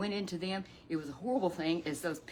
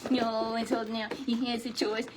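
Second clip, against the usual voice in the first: lightly higher puppet voice; 4 semitones higher than usual. Acted. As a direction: puppet sidekick chiming in.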